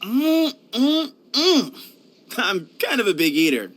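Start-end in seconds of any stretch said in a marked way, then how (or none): 0.0-1.8 high, appreciative food hums; eager eating hums. Acted. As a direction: hum happily over a good meal. Eating heartily, admits big eater.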